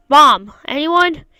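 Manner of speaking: loud, slow call for mom; calling out for mom in an empty hospital room; confused yell. Acted. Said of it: "I yell out in confusion".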